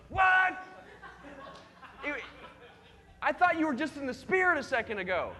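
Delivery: loud, high mock outrage; indignant outburst. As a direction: blow up at the line-cutter. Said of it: "and you're like".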